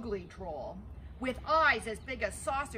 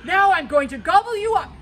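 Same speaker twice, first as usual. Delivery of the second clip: very loud troll threat; troll threatening the big goat, very loud; 7 semitones higher, 14 dB louder than usual. Acted. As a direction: roar the threat.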